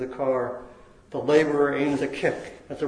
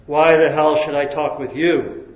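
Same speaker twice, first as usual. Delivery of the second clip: roar; 8 dB louder than usual. A narrator's reading. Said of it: "roared above the clacking wheels".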